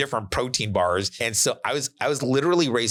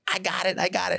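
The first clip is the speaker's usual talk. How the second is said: higher, lightly voiced brag; a buddy crowing a little higher; gloating buddy; 8 semitones higher than usual. Buddy across the stadium bragging he got it, mild high crow. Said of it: "and he's like".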